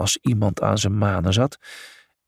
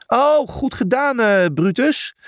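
hoge, uitgeroepen verzuchting; verzuchtend; 11 semitones higher, 3 dB louder than usual. A narrator's reading. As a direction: verzucht het met hoge stem. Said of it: "verzuchtte Julius". (hoog verzucht)

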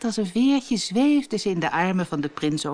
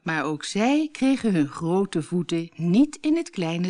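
plain reading voice

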